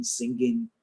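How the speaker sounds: ordinary delivery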